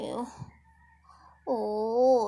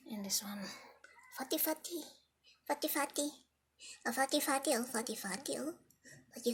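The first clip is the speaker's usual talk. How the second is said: soft unvoiced whisper to the kitten; hushed fatty fatty; 9 dB quieter, much faster than usual. Real, not acted. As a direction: whisper the pet name fondly.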